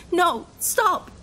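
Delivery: high, loud pleading cry; desperate plea. Acted. Man pleading as the officer drags him away. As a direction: beg them to let go.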